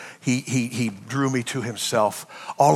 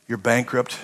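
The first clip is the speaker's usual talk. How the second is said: normal talking voice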